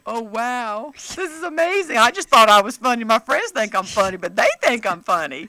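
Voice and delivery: amazed gush. Retold or imitated. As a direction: act stunned to be liked.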